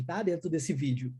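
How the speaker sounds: ordinary delivery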